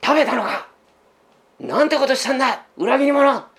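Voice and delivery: a sharp accusing scold, lightly acted; scolding interrogation. Preacher voicing God scolding Adam as traitor, mock angry. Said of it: "叱りつける声で".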